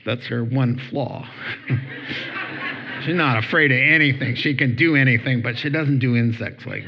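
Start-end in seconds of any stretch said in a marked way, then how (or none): 1.3-3.0 soft airy laugh at his own joke; fond little laugh about his wife; quiet breathy laugh. Real, not acted. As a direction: let out a hushed chuckle. Laughs at wife's one flaw.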